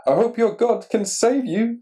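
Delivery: a hint of a worried king; the king's hopeful plea. A narrator's reading. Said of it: "said the king".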